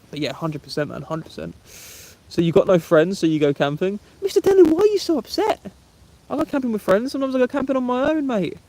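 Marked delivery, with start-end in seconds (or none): 4.2-8.6 very high, squeaky mock protest; mock upset. Streamer answering a no-friends jibe, mock upset. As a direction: put on a comically high, wounded voice.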